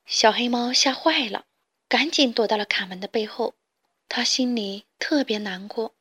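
plain reading voice

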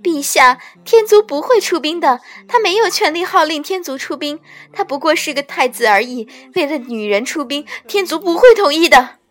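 high, loud desperate begging; acted plea, pitched high and urgent; pleading. A narrator's reading. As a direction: frantically implore the king. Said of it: "继而又哀求道".

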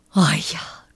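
low, whispery sigh. A narrator's reading. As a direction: sigh it out under your breath. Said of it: "叹着气说". (低声长叹一口气)

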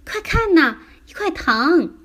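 put-on high, loud excited child's cry; happy shouting. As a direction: call out in delighted discovery. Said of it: "高兴地大喊起来".

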